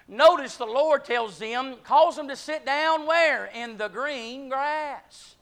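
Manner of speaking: loud, high, slow preaching climax; preaching at full force. Acted. Preacher calls the church out of the desert, rising to a shout. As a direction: build to a shout, hammering each not and out.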